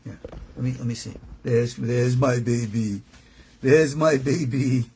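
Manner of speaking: ordinary delivery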